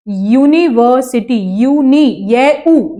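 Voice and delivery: यूनिवर्सिटी stretched slowly, then यूनी split off; slow sounding out. Shown as a demonstration. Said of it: "हम इसको यूनिवर्सिटी बोलते हैं".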